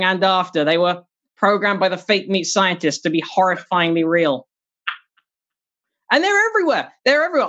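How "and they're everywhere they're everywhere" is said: high, exasperated cry of disbelief; exasperated outburst. Real, not acted. Telling the audience about fake meat burgers, exasperated and high. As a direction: throw it up high like it's absurd.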